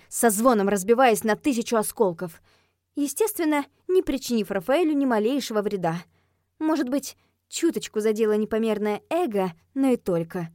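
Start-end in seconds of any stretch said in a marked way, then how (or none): none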